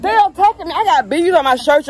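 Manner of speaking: high, loud alarmed cry about the bees; real panic, pitched high and loud; panicked yelling. Real, not acted. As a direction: yelp it out as the bees swarm you. Bees attacking her while filming.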